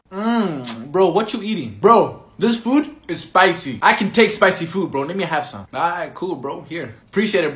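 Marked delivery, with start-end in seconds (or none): none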